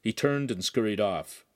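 ordinary delivery, nothing marked

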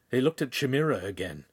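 usual speaking voice

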